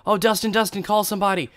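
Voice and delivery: high, rapid mock cries of a panicked wife; imitated panicked yelling. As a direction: act out her frantic phone call without full screaming. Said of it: "I just hear her screaming. She's yelling".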